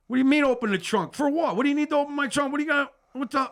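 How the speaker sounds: high, loud disbelieving protest; can't believe they want the trunk opened; incredulous outburst. Retold or imitated. Telling the cops he can't believe they want his trunk, high and loud.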